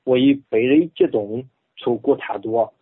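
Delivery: Bible reading in Henan dialect; measured Henan dialect recitation. Shown as a demonstration. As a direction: read scripture in a rustic Henan accent. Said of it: "用河南话".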